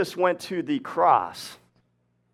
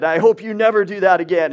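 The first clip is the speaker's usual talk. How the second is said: louder, higher mock scolding; pretend mad; 6 semitones higher, 7 dB louder than usual. Teacher fakes scolding a student before the class, pretend mad. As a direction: put on a show of telling someone off. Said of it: "I pretend I'm all mad".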